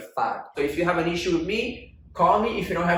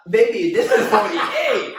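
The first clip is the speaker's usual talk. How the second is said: laughing; 6 semitones higher, 8 dB louder, slower than usual. Real, not acted. Correcting wig length, laughing.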